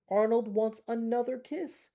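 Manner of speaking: high, loud little toy voice; reading a bedtime story to kids, voicing Arnold. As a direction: squeak it out as the needy toy.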